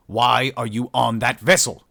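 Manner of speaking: loud, slow demand. A narrator's reading. Shipmaster interrogates the human over the link, demanding. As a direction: hammer each word as a demand. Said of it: "Herkurtzel demanded".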